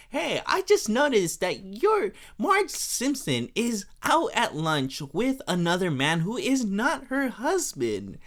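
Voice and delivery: high, put-on busybody voice; hammed-up tattling churchgoer; nosy gossip. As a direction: announce the scandal in a high, pointed voice. Gossip Helen Lovejoy accosts Marge.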